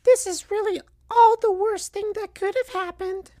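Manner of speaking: tiny, very high falsetto voice; Knuckles Junior, sad. A narrator's reading. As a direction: squeak sorrowfully like a little cartoon kid.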